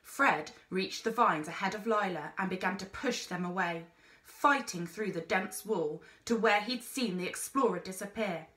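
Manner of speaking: plain reading voice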